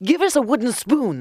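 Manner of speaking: high-pitched calling; high, urgent cry. A narrator's reading. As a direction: call eagerly at a neighbour's door. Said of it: "they cried".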